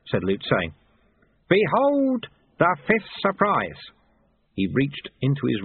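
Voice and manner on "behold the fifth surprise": slow, high mock-grand announcement; theatrical, pitched-up flourish; grand unveiling. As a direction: draw it out like a showman.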